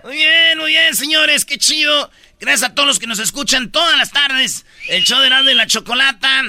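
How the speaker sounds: high-pitched host patter to a crowd; stage MC. Acted. Radio comic stalls onstage, hyped host delivery. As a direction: thank the audience like an event host.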